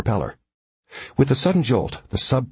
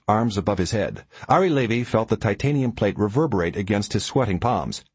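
ordinary delivery, nothing marked

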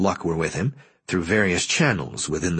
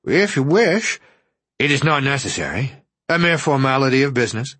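mock boredom; 7 semitones higher, 4 dB louder than usual. A narrator's reading. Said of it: "made himself sound a trifle bored".

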